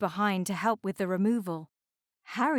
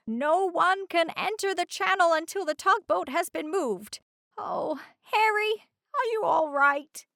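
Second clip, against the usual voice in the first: higher lighthouse voice, ending worried; Lighthouse Marjanimi; 9 semitones higher than usual. Acted. Lighthouse radios the channel news and checks on Harry, higher and concerned. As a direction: solve the mystery, then fret over Harry.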